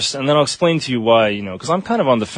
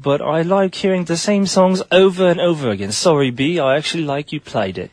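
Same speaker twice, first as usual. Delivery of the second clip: high British voice reading the tweet; British accent; 6 semitones higher than usual. Acted. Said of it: "Mayanne who said".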